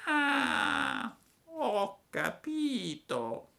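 acuta e lenta; lumaca. A narrator's reading. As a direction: capisce con calma infinita. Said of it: "disse la lumaca". (la lumaca capisce, lenta e acuta)

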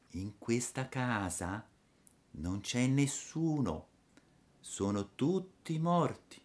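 put-on slow, soft ghostly girl; otherworldly little voice. A narrator's reading. Dead girl at the window, faint otherworldly voice. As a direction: drift the words out softly, as if from beyond. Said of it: "con una vocina che pareva venisse dall'altro mondo".

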